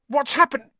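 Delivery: high, loud gasped question; gasping. Acted. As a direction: gasp it in shock. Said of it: "Ron gasped".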